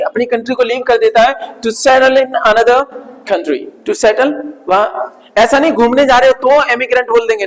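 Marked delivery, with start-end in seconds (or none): 1.6-4.3 slow dictation. Shown as a demonstration. Teacher dictates the English meaning, slowly. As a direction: dictate the definition word by word, slowly. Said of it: "teacher dictates definition".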